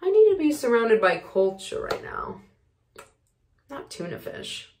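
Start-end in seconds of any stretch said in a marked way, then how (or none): 3.7-4.7 whispered aside. Acted. Muttered aside after monologue.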